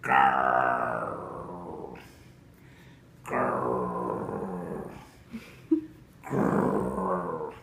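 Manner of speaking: deep slow loud growl; tiger growl. A narrator's reading. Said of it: "It went grrr".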